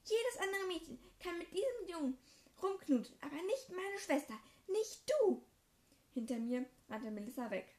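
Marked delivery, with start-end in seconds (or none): 0.0-5.4 high, loud refusal building to a cry; furious yelling. Acted. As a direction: hurl it at your sister. Draco rages at sister.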